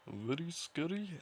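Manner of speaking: high, slow mock Indian accent; exaggerated Indian accent. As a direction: put on an overdone Indian accent. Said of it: "Is that a real accent ... stereotypical Indian individual".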